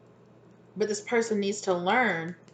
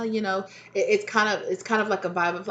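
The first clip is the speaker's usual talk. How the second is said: normal talking voice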